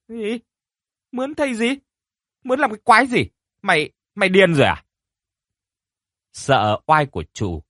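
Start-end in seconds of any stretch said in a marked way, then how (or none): 0.1-4.8 much higher, sharp furious retort; angry snap. A narrator's reading. Mistress snaps at the maid for suggesting a shaman, furious and high. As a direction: lash out in disbelief. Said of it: "quát".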